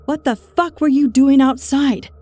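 loud, high furious demand; angry demand. A narrator's reading. Woman bursting through door, furiously demanding answers. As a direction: tear into him as she bursts in. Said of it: "she demanded".

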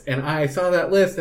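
ordinary delivery

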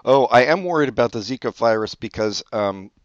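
ordinary talk

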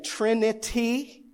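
"Trinity" said slowly, syllable by syllable, rising; careful restart. Real, not acted. Pastor retrying a stumbled word, slow careful "Trinity". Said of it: "let me start that again".

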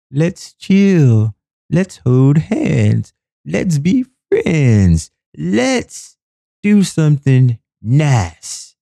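high, slow, sweet mocking voice. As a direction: coo it like a syrupy love song. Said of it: "I don't need anything about".

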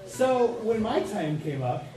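ordinary delivery

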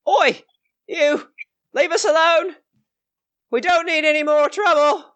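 put-on high-pitched boy putting on a brave front; defensive farm boy. Farm boy warning approaching strangers away, high defensive shout-like voice. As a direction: warn strangers off in a high young voice. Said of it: "tries to make himself look big".

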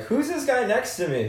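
comic high-voiced band joke. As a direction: play dumb in a squeaky voice. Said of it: "Tom's like".

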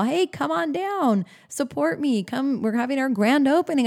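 high, sing-out welcome, easing down as it goes; proud, excited invitation. Acted. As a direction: invite friends to your big day. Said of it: "loud and proud".

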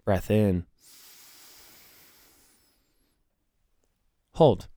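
calm, soft breathing cues. Acted. Leads a breathing exercise.

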